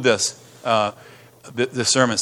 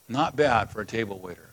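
softer, lower echo of "Not bad for a table waiter"; quieter repeat; 3 semitones lower, 6 dB quieter than usual. Shown as a demonstration. Pastor echoes his point to the church, softly. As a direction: let the line settle quietly. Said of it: "repeated softer".

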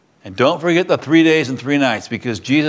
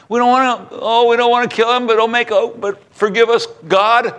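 high, anguished plea to God; desperate sailors; 9 semitones higher than usual. Retold or imitated. Sailors about to throw Jonah overboard, high anguished plea. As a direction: cry out, begging forgiveness.

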